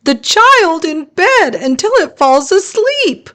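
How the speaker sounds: very high squeaky voice; squeaky monster. Acted. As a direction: squeak it out like a tiny eager monster.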